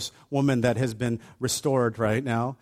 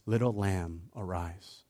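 soft, low and tender; Jesus gently waking the girl; gentle; 6 semitones lower, 9 dB quieter, slower than usual. Retold or imitated. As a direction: coax her awake. Tender word to dead girl.

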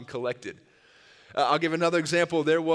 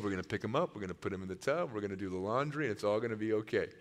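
low, soft, steady reassurance; calm a panicking husband down; cool and calm; 6 semitones lower, 8 dB quieter, faster than usual. Retold or imitated. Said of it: "as cool as could be".

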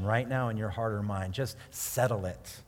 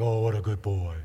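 mock pet praise; 5 semitones lower, 3 dB louder than usual. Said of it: "we're like".

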